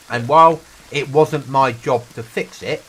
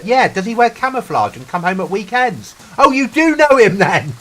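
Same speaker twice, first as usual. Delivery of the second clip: high, hammed-up naive enthusiasm; imitated excited civilian; 10 semitones higher, 6 dB louder than usual. Civilian sure the squaddie knows Dave, mock-excited insistence. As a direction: build to a loud, high, delighted mock realisation.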